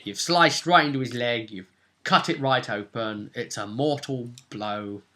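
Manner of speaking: ordinary talk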